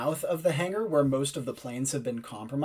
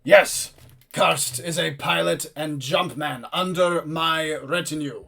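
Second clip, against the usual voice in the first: powerful commander, loud opening, then slow; slow, formal military voice; Holthrein; 4 dB louder, slower than usual. Acted. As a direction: answer with booming, measured authority. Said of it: "a powerful voice".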